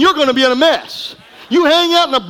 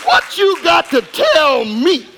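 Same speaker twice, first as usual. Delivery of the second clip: slow, shouted challenge; preaching shout; 4 semitones higher, slower than usual. Acted. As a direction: hurl it at the crowd, slowly.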